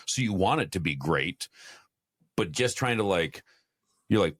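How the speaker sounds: usual speaking voice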